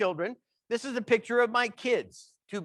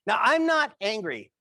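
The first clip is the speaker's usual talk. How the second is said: ordinary delivery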